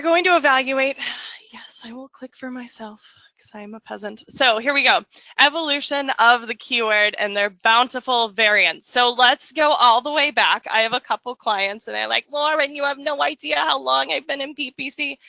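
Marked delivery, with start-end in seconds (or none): 1.5-4.2 breathy whispered self-mocking aside. Real, not acted. Presenter clicking her own slides, whispering a joke aside. 12.3-15.2 put-on lightly done New Jersey accent for a client; a mild New Jersey client voice. Presenter mimicking a veteran client, light New Jersey accent. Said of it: "made them from New Jersey".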